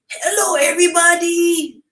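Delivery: high, loud puppet-monster greeting; Grover voice. Retold or imitated. Mother greeting her kid as Grover, high and loud. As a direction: squeak it out like a furry muppet. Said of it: "I can't do Grover's voice".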